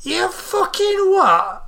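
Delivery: very high, drawn-out incredulous question; outraged disbelief. Acted. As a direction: stretch it out in high indignation. Old woman hearing absurd excuse.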